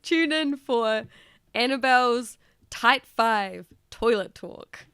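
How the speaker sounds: drawn-out mock announcer plug; teaser announcement. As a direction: announce it slowly, word by word.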